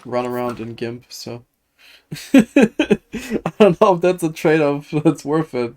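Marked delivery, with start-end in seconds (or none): 2.3-5.8 laughing. Real, not acted. Streamer laughing at the gimp outfit, laughing slowly. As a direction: laugh through the words, louder and slow.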